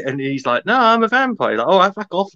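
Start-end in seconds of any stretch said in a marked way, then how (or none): 0.7-1.5 high, louder insistence; insisting. Retold or imitated. Guy in the bar insisting he is a vampire, high and emphatic. 1.7-2.3 high startled backing-off; scared retreat. Retold or imitated. Barman backing off from the vampire, high and startled.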